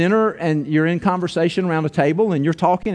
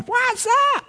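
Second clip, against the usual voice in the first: hammed-up startled yelp, much higher; squeaky mock what's up. Baffled churchgoer squeaks in mock confusion. Mocks the reaction of weirded-out listeners.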